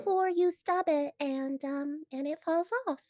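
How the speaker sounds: high squeaky cartoon character voice. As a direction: chirp the advice like an old cartoon. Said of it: "you have to give the medical advice in a cartoon voice".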